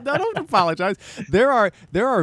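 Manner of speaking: high, laughing protest. Real, not acted. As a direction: laugh the words out. Laughing protest to apologizing guest.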